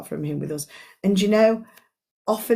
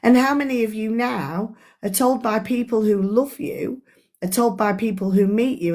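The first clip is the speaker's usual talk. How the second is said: ordinary delivery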